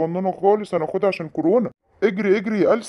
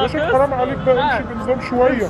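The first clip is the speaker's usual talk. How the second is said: higher-pitched complaint in traffic; exasperated driver; 6 semitones higher, 3 dB louder than usual. Acted. Doctor grumbles at the other driver, exasperated. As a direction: plead crossly with a bad driver.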